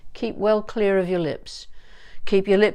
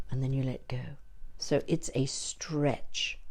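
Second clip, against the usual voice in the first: whisper; 6 semitones lower, 11 dB quieter than usual. Real, not acted. Aside while explaining the stretch.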